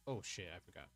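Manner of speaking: ordinary delivery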